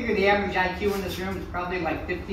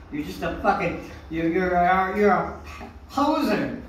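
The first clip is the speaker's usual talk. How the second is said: ordinary talk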